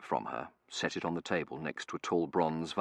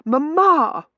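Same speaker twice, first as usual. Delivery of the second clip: very high, loud cry to mother; high-pitched daughter's plea; appealing daughter; 14 dB louder than usual. A narrator's reading. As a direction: call out shrilly to Mamma in appeal. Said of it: "appealing to her mother".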